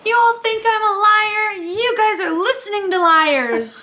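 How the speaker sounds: put-on high, singsong indignant child; mock kid preaching, slowing down; first grader lecturing his class, high. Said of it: "he's like".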